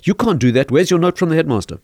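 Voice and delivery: put-on mild stern rebuke to a child; stern teacher. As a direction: order the boy down firmly. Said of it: "in a very stern voice".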